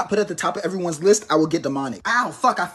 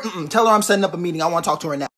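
ordinary talk

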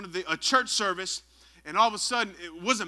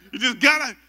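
ordinary delivery

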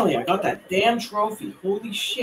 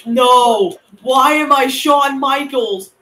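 loud, high protest; slow, outraged whine at full volume; dismayed shouting; 7 semitones higher, 6 dB louder, much slower than usual. Real, not acted. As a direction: yell it in drawn-out disbelief. Dismayed at game match-up.

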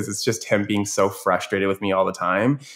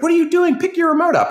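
put-on high, loud yell of a frantic gamer kid; screaming kid; 4 dB louder, faster than usual. Kid yelling at his coach mid-battle in a high, loud screech. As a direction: shriek it like a kid in a panic. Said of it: "he's like".

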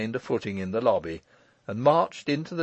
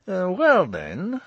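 flustered cry; 8 semitones higher, 5 dB louder, slower than usual. A narrator's reading. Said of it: "he cried, with another deep flush".

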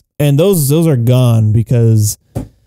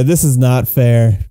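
ordinary talk

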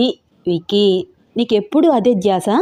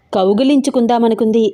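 usual speaking voice

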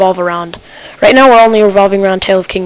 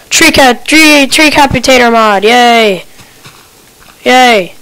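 cheering; 3 semitones higher, 5 dB louder, slower than usual. Real, not acted. Happy about tree mod.

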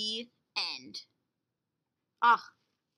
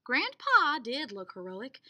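ordinary delivery, nothing marked

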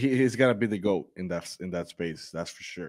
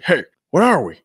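lightly imitated movie-star voice, raised and louder; Mark Wahlberg; 4 semitones higher, 7 dB louder than usual. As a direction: toss it out as a quick star impression. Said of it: "he's got such a cool voice".

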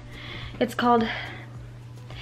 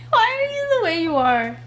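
put-on teasing baby talk; 6 semitones higher, 3 dB quieter, slower than usual.

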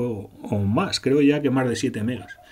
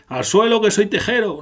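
high, loud hammed-up threat; mock villain boast; 11 semitones higher, 6 dB louder than usual. The narrator mocks the dark elf's attack, in a high hammed-up boast. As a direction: ham up a silly villain line.

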